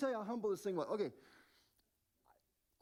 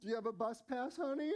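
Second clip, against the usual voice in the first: sneering clerk voice, yelped far higher; mocking store clerk; slower than usual. Young Apple clerk mocks the dad saving for a phone, sneering. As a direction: taunt him like a snotty teen clerk. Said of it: "like, going".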